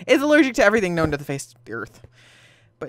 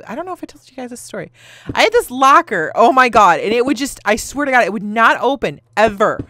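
ordinary talk

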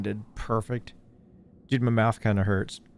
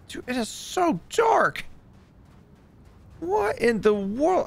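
high, slow astonished voice; amazed disbelief; 11 semitones higher, slower than usual. Real, not acted. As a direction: gasp at how dark it is. Amazed at the darkness.

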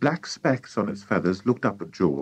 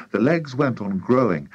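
usual speaking voice

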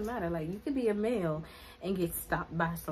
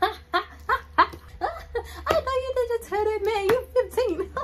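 high, loud, laughing through the words; mom laughing; 14 semitones higher, 7 dB louder than usual. Retold or imitated. Mom on the phone laughing at her daughter's rebuff, high and loud. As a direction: cackle at her daughter's comeback. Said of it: "all I hear is".

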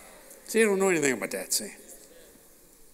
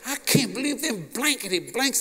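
slow, higher mock rant with blankety blanks; mock cussing; 7 semitones higher than usual. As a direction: cuss them out for show, bleeped. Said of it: "He start cussing".

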